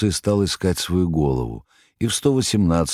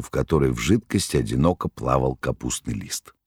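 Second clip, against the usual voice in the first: usual speaking voice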